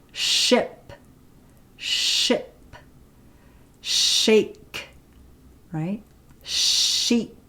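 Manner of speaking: careful "ship", "shake", "Sheep" with clear "sh"; example words demo. Shown as a demonstration. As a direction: stress the initial "sh".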